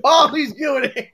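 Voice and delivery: slow words through laughter; laughing. Real, not acted. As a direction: laugh your way through it, drawn out. Laughing at his own sign-off.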